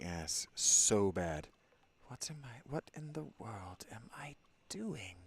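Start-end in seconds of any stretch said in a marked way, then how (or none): 2.1-5.1 hushed whisper to yourself. Real, not acted. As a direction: whisper it, sneaking around. Sneaking, whispering to self.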